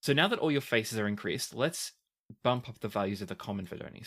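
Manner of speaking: ordinary delivery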